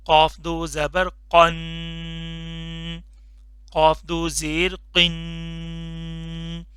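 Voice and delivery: very slow, drawn-out drill for repeating; slow drilled recitation. Shown as a demonstration. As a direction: stretch each syllable for the class to echo. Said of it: "मोटा वाला कॉफ है". (बहुत धीमे, खींचकर पढ़ाना)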